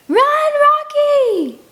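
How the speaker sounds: very high, loud, drawn-out shriek. Acted. Girl shrieks to warn Rocky to flee, shrieking. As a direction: shriek his name with everything. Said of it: "I shriek so loudly".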